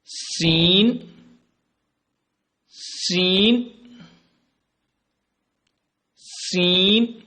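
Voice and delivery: سین drawn out very slowly with a long whistle; hissing seen. Shown as a demonstration. Teacher hissing سین slowly for viewers. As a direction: stretch سین into a long hiss. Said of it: "سٹی کی طرح".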